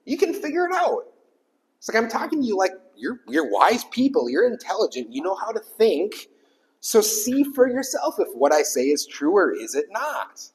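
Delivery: high, bright retold apostle voice; lightly voiced Paul. Pastor voices Paul praising the readers, high. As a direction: voice Paul coaxing them upward. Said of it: "So he's like".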